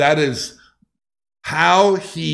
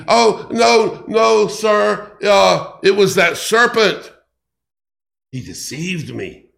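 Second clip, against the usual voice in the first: Eve higher, flustered, shifting blame; a nervous higher voice passing the blame; flustered excuse; 4 semitones higher than usual. Acted. Eve excusing herself to God.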